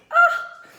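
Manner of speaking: a sudden high, loud gasp of delight; bright surprised "ah" at high pitch. Retold or imitated. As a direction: gasp like you just got a lovely compliment. Delighted reaction to a compliment.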